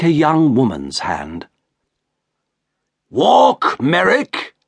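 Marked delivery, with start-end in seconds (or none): 3.1-4.5 angry order. Acted. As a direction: bark it at the creature. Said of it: "said the shopkeeper angrily".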